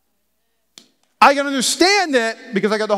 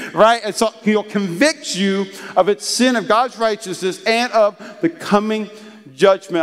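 ordinary delivery

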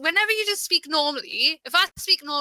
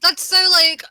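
high, loud disbelief; shocked outrage at the story; 7 semitones higher, 7 dB louder than usual. Real, not acted.

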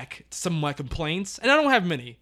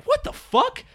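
very high disbelieving cry; incredulous; slower than usual. Retold or imitated. Complaining about a plot hole, high disbelief. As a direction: squeal it in disbelief. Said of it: "my big moment of like".

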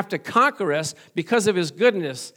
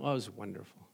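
offhand praise; 10 semitones lower, 14 dB quieter, faster than usual. Retold or imitated. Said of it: "we use that term loosely".

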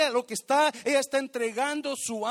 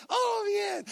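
fake cheerful answer; 11 semitones higher than usual. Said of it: "la gente dice".

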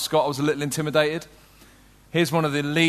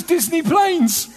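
put-on high, slow child's voice; little son voice; 11 semitones higher, much slower than usual. Telling the congregation his son's answer, in a high slow child voice. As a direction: mimic a small boy answering proudly. Son's reply in retold anecdote.

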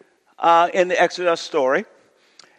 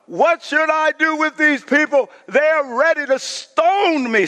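pitched-up plea; desperate Moses; 8 semitones higher than usual. Acted. Moses crying out to the Lord about the people, desperate. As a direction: cry out to God at wit's end.

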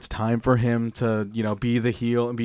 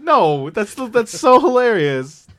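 laughing; 11 semitones higher, 7 dB louder, slower than usual. Real, not acted. Cracking up at the idea.

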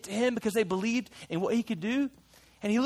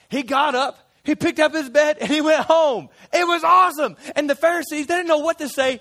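higher and louder, bursting with excitement; excited storytelling; 6 semitones higher, 6 dB louder than usual. Acted. The disciple tells the crowd about the healed man, thrilled. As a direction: tell it like the best news ever. Triumphant climax of healing story.

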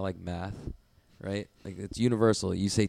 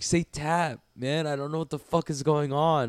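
higher retold frustration; exasperated plea; 6 semitones higher, 4 dB louder than usual. Grappler pleading with visitor to say tap, exasperated. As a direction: demand it in baffled exasperation.

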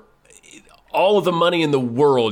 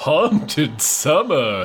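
spooky voice; 3 semitones higher, much slower than usual. Pretend. Mock spooky greeting.

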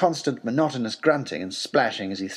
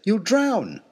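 high, pleading warning; alarmed warning; 8 semitones higher than usual. Acted. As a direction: warn him he'll drown. Narrator warns the professor.

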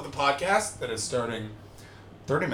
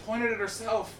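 normal talking voice

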